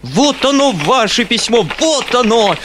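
high, loud ranting tirade; furious shrieking. A narrator's reading. Foma rants at the uncle, shrieking furiously. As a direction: tear and stamp on the letter in fury. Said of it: "взвизгнул Фома, мгновенно воспламеняясь".

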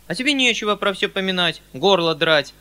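громкий, повышенный укор; отчитай мужика с укоризной; укоризна. A narrator's reading. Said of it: "сукоризной обращаясь к мужику". (укоризненный выговор)

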